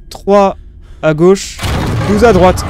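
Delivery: calling out the code; loud excited. Real, not acted. Entering combination, rising excitement.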